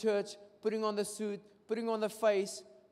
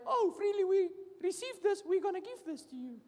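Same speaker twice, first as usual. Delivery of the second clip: much higher, sing-song sarcastic voice; mock venue owner; 13 semitones higher than usual. Preacher imagines the venue owner giving it away, high mock voice. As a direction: play a naive venue owner, silly and sweet.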